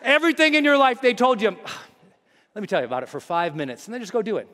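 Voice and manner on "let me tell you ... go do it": quick, soft, low brush-off; mock breezy instructions; offhand advice. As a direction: rattle it off casually. Mimicking breezy advice givers.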